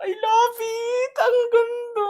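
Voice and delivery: high, slow, near-crying squeal; teary joyful squeal. Real, not acted. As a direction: gush with happy tears. Squealing over her new bag. (halos umiiyak na tili sa tuwa)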